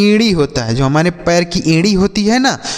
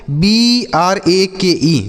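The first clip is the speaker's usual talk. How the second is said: each letter spelled slowly and apart; slow spelling out; 3 semitones higher, much slower than usual. Shown as a demonstration. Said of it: "तो इसे हम पढ़ते हैं break". (अक्षर-अक्षर धीरे बोलकर)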